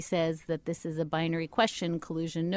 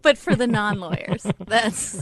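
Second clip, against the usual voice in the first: louder, talking through real laughter; laughing; 3 semitones higher, 8 dB louder than usual. Real, not acted. Guest teased for name-dropping, answering through laughter. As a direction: laugh your way through the words.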